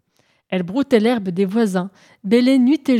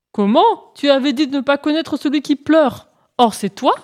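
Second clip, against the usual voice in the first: higher, louder astonished question; surprised; 5 semitones higher, 4 dB louder than usual. Acted. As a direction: catch him out in surprise. Hippopotame surpris démasque le lièvre. (surprise de l'hippopotame qui démasque le lièvre)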